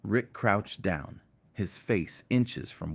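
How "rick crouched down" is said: usual speaking voice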